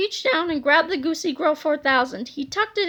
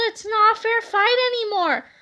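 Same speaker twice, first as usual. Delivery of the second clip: indignant shout; 6 semitones higher than usual. Acted. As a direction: shout that it's unfair. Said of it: "cried Harold".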